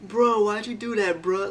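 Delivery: lightly voiced tall friend, slow and puzzled; drawn-out, confused bro; a hint of a bemused buddy, unhurried; impression of James. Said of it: "so he's like".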